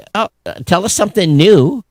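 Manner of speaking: high, mock-unimpressed scoff; sarcastic retort. Host mocking the CEO's podcast claim, sarcastic and pitched high. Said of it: "And I'm like".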